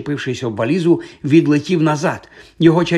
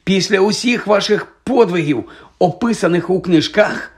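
higher, incredulous question; disbelieving; 6 semitones higher than usual. A narrator's reading. Boy questioning his hero's feats, incredulous. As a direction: press him in disbelief. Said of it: "усе ще не міг повірити Гаррі".

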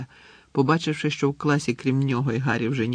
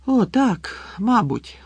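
high, relieved sigh falling away; higher-pitched, easing down with relief; 9 semitones higher, 4 dB louder, slower than usual. A narrator's reading. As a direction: let the relief out as you agree. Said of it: "полегшено зітхнув Гаррі".

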